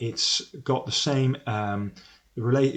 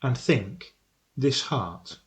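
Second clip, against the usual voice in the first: measured poetry reading, pausing after each phrase; poem recitation. Acted. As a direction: recite it solemnly, line by line, with weighted pauses. Recites the sonnet to students.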